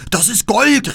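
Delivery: viel höher und lauter, „Gold“ gierig hinausgeschrien; Räuberschrei. Acted. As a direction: den Ruf steigern, bis „Gold“ gellt. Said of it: "riefen sie und stürzten hervor". (Räuber stürzen hervor: gieriger, hoher Schrei)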